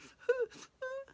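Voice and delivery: high acted sobs; tearful sobbing. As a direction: whimper and sob after the goodbye. Weeping woman after the farewell.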